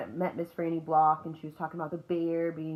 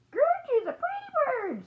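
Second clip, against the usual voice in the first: high, slow parrot screech; drawn-out bird voice, pitched way up; screaming parrot; 4 dB louder, slower than usual. A narrator's reading. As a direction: squawk it out like a talking parrot. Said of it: "screamed Gertrude".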